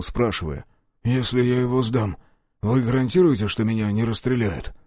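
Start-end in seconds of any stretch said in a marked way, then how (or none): none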